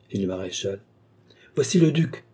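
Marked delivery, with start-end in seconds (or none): 1.5-2.2 higher-pitched cry of news; exclaiming. A narrator's reading. Lookout Jean announces the duke's arrival, exclaiming. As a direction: call out the sighting, pitched up. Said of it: "s'écria Jean".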